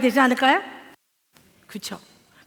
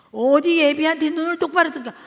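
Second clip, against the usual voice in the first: mock scolding; 6 semitones higher, 7 dB louder than usual. Father scolding daughter.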